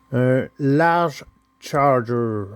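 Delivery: slow careful English "large Charger"; drawn-out English-accented car name. Shown as a demonstration. Showing off his English accent on the toy car name. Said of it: "Avec mon beau, bel accent en anglais".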